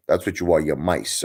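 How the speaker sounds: ordinary talk, nothing marked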